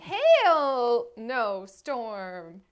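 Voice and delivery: very slow, savoring each word; drawn-out repeat. Retold or imitated. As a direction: stretch it out mockingly. Repeating a funny line.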